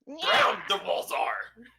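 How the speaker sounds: breathless little Yoda whisper, slowed; Yoda-style whisper. Pretend. Joking about the walls, a slow mock Yoda whisper. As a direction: hush it like a tiny Jedi master, slow. Yoda-like inverted joke line.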